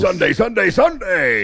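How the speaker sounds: booming high announcer hype, pumped up; monster truck ad. Retold or imitated. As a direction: sell the Sunday showdown like an arena ad. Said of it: "the guy who does the voice for the monster truck, uh, commercials".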